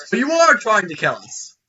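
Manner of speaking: loud, high-pitched challenge; orc's yelled accusation. Acted. Orc challenges the traveler over his intent, yelling. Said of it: "And then Eric says".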